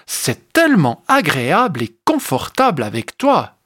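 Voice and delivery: ordinary delivery, nothing marked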